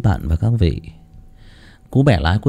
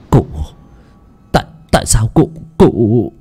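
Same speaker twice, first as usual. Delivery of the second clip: halting, gasped-out question; shocked stammer; 3 semitones higher, 5 dB louder than usual. Acted. Thầy Nghiêm turning pale, asking Lão Tuân why, shocked. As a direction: stumble in disbelief, broken by pauses. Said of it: "Thầy Nghiêm tái mặt".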